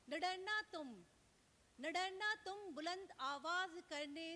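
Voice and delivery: rousing mushaira declamation, rising on the exhortation; lifted, ringing verse delivery; Urdu nazm recital. Acted. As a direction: recite it as a rallying poem, then settle on the refrain. Poet repeats the stanza.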